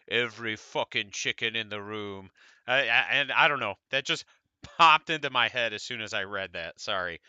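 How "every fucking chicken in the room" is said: lower, soft mock English accent; the Hound, English accent. Quoting a Game of Thrones scene to viewers, in a mock English accent. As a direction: growl it in a put-on English accent. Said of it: "offended by my atrocious accent".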